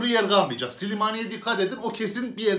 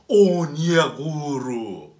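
mocking drawn-out name; 3 semitones lower, much slower than usual.

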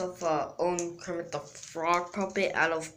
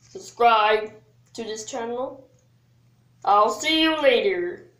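high, fast puppet frog voice; squeaky Kermit impression; 5 semitones higher than usual. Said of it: "that's actually my Kermit the Frog voice".

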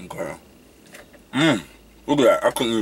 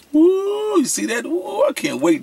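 excited woo. Real, not acted. Tasting snacks on camera, a high excited whoop. Excited about strawberry cupcake.

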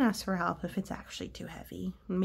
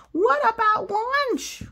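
high, fretful bird voice; worried cartoon pigeon asking; 13 semitones higher, 8 dB louder, slower than usual. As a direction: play the anxious pigeon, pitched up. Adult voices Pigeon in story.

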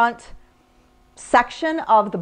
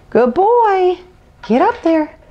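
excited dog praise; 7 semitones higher, 4 dB louder, slower than usual. Real, not acted. Praise and urging, high.

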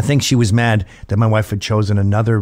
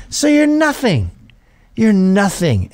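higher, slow, mocking sneer at him; drunk woman; 7 semitones higher, slower than usual. Drunk woman sneering at his flag mask, higher and slow. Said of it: "drunk woman quoted".

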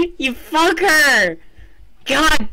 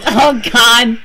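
normal talking voice

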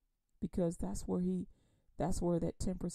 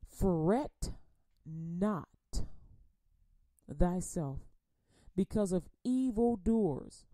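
solemn slow Psalm reading; slow scripture reading; 3 dB louder, slower than usual. Acted. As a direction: read each word slowly so it sinks in. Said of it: "I'm gonna read it slow".

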